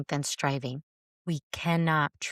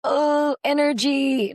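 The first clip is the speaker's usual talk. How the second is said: scandalised gasp of energy, high and loud; mock horror; 8 semitones higher, 6 dB louder, slower than usual. Mocking Christians who fear the word energy: high, loud, scandalised. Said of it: "those Christians that are like".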